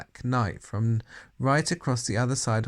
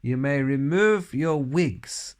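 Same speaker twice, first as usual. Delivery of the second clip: slow, loud, snarled command; witch's snarl; 3 semitones higher, 5 dB louder, slower than usual. A narrator's reading. Grand High Witch to her witches, snarling. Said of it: "snarled the Grand High Witch".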